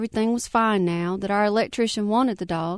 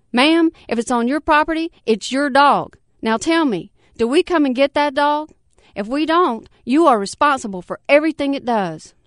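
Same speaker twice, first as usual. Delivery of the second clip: insistent official; 6 semitones higher, 6 dB louder than usual. Acted.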